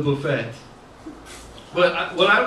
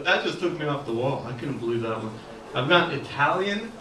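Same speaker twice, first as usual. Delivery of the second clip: ordinary delivery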